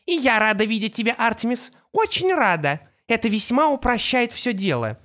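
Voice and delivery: high, sweetly gloating villainess; Opal voice. A narrator's reading. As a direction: purr the words with mock delight.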